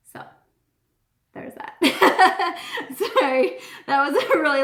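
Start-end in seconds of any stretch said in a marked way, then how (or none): 1.8-2.9 laugh. Real, not acted. Laughs after long story.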